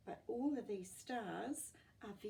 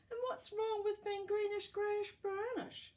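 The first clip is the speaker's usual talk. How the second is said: worried mum; 8 dB louder than usual. A narrator's reading. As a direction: fret over it like an anxious mother. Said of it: "worried Oscar's mum".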